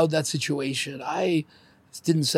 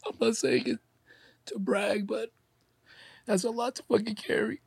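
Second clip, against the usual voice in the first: tearful; 6 semitones higher, 7 dB quieter than usual. Real, not acted. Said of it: "that's why I'm crying".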